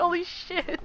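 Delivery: high, stunned gasp of delight; freaking out. Retold or imitated. Streamer recalls her reaction to being greeted, high awed exclaim. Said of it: "I was, like, freaking out".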